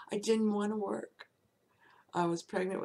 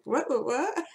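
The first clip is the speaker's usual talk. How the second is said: sharp, high, loud startled "what"; jolted out of her typing; startled outburst; 6 semitones higher, 7 dB louder than usual. Retold or imitated. Startled at a groping boss, a high loud outburst.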